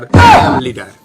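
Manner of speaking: loud proud boast; boastful shout. Acted. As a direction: bellow it like a swaggering leader. Mock boast in English.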